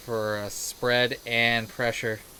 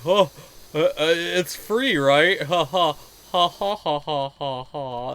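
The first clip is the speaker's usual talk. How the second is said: louder, fake chuckling know-it-all; smug customer joke; 3 semitones higher, 6 dB louder, slower than usual. Customer joking at the register, smug and fake-laughing. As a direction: ham up a customer who thinks he's clever. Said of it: "decides to be like".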